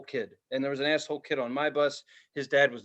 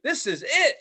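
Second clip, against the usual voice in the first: put-on excited kid; 10 semitones higher, 3 dB louder than usual. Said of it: "I was just like".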